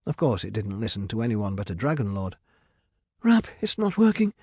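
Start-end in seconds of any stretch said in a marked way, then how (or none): 3.2-4.3 high, loud cry of panic; scared. A narrator's reading. Medea's magic fails on the dragon, scared. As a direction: call out to your sister in fright. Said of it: "She felt scared now".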